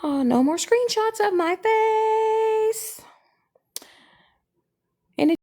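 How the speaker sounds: high, slow, floaty moon voice. Pretend. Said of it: "Maybe I'll just be a rambling moon".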